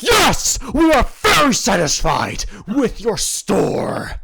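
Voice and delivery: booming, slow, overjoyed himbo; dopey sweet himbo. Acted. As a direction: shout it like a delighted big lug. Bang answers the gnome.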